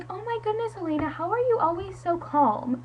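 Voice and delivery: high, put-on amazement; mock gushing classmate. Acting out Becca's gushing question.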